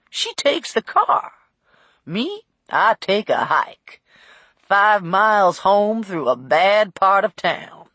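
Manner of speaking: folksy driver swapping a story; lightly acted old driver's yarn; chatty old cab driver. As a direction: ramble like a talkative cabbie. Narrator plays chatty cab driver.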